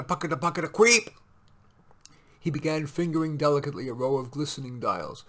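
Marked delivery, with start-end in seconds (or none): 0.0-1.1 rhythmic chugging ending in a loud high screech; chug-chug, then a sudden loud squawk; machine noise imitation. A narrator's reading. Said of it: "which was now going pocketa".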